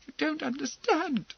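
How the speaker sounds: plead, near tears; young girl close to tears; frightened girl. A narrator's reading.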